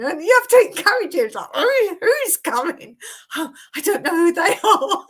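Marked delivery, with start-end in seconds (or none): 1.6-5.1 high, loud mock panic of a dreamer; dreaming husband, imitated with laughter; mock sleep talk. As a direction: play the scared sleeper, laughing through it. Coaxing and answering in sleep.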